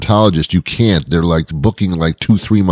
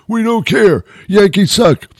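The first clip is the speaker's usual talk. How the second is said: much higher, squeaky jeering fan; mocking fan in a high voice. Cubs fans voiced, mocking.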